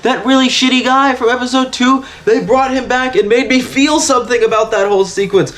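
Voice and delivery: high-pitched fan gushing; thrilled, emphatic disbelief; real excitement. Real, not acted. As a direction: gush it, pitch way up. Thrilled at a character's return.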